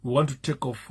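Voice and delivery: ordinary talk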